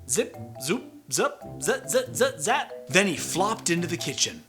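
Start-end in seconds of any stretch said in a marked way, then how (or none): none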